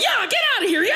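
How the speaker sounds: loud, high shooing yell at the horses; locals shooing horses. Retold or imitated. Townsfolk shooing mangy horses, hollering. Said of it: "people are like".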